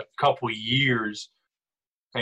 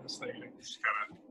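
unvoiced mock-grumpy whisper; 11 dB quieter than usual. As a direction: whisper it like a secret gripe. Mock complaint to host.

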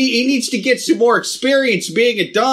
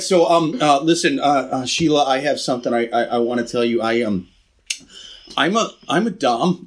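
nervous confession; 8 semitones lower than usual. Acted. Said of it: "His voice breaks".

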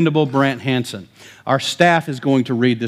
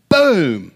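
loud mock boom of a rock hitting metal; imitated crash noise; rock smash; 4 semitones higher, 5 dB louder than usual. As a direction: shout out the crash like a kid.

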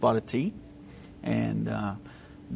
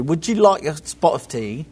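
mock British accent, pitched up and a bit louder; 5 semitones higher, 5 dB louder than usual. Texan podcaster imitating how British people offer tea, in a mock British accent. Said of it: "You know how the British people say".

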